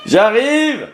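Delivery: high, loud call upstairs. Real, not acted. Calling up to fighting daughters. (appel crié)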